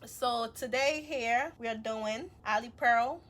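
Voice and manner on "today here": ordinary talk, nothing marked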